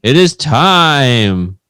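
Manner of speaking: big, drawn-out announcer build; show announcer. Acted. Announces segment outro and intro.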